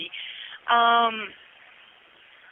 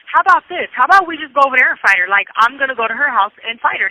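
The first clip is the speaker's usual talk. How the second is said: louder, higher, fast angry challenge; heated outburst; 5 semitones higher, 6 dB louder than usual. Retold or imitated. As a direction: push it out like you're ready to fight. I'm very upset.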